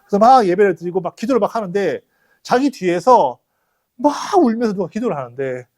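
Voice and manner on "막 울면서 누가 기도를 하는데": normal talking voice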